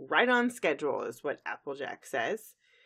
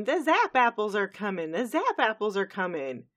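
raised, louder excited cry; mock scream; 5 semitones higher, 5 dB louder than usual. Granny Smith running around announcing the harvest, mock screaming. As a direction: call it out as an urgent announcement. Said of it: "running around screaming".

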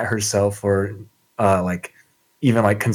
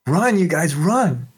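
yelling; 7 semitones higher than usual. Acted. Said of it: "she yells at the group".

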